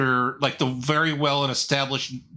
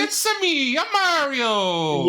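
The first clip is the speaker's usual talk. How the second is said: high, slow cartoon plumber voice; squeaky game-hero catchphrase, drawn out; Mario voice; 9 semitones higher, slower than usual. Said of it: "the guy who's voiced Mario".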